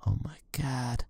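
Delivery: shaken, whispered under the breath; breathless whisper. Real, not acted. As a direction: whisper it in shock. After chase, hiding.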